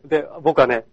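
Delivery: ordinary talk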